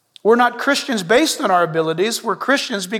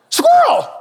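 sudden high, loud yelp of "Squirrel!"; excited dog cry; 6 dB louder than usual. Retold or imitated. Playing the Up dog, he yelps "Squirrel!" sharply. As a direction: blurt "Squirrel!" like a distracted cartoon dog.

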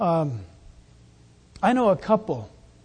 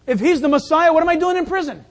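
incredulous protest; 6 semitones higher, 5 dB louder than usual. Acted. Voices John questioning from prison.

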